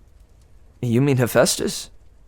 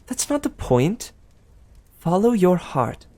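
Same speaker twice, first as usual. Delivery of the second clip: higher, sweet gossiping goddess; Aphrodite; 8 semitones higher than usual. Acted. Aphrodite teasing Percy about Annabeth, higher and sweet. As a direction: coo it like a doting goddess.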